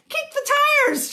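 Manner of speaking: high, loud frantic order; storybook cow shouting; urgent shout. A narrator's reading. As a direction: yell instructions in a panic. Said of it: "shouted Moo".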